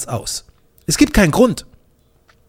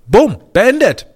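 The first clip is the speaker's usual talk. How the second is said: normal talking voice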